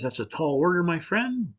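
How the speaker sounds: the genie pushes back with a big sceptical grin. Acted. Genie answers farmer in joke.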